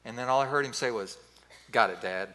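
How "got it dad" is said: low-pitched, deadpan acceptance; re-enacted grudging surrender; curt resigned reply. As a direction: give in tersely, voice dropped well down. Son's resigned reply before hanging up.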